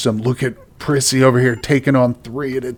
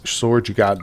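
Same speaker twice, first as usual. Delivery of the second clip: ordinary talk, nothing marked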